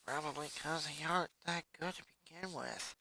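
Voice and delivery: quiet sarcastic mutter. A narrator's reading. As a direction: grumble it to yourself. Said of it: "Luna muttered".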